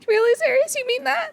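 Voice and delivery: very high, hopeful question; wiping tears, can't believe it; touched disbelief. Acted. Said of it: "They're like".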